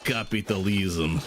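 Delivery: put-on slow, disapproving word in a thick Russian accent; Arnold's Russian accent. Retelling the movie scene to chat, slow Russian accent. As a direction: shake your head and say it in a heavy Russian accent. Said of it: "In that horrible accent of his".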